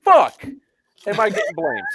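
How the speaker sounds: indignant protest. Real, not acted. Player protesting being blamed, indignant. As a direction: push back hard, stretching the opening words.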